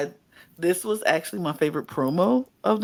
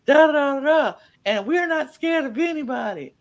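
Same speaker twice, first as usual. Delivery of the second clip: mock taunting; 6 semitones higher, 3 dB louder than usual. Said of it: "Sonya Deville was like".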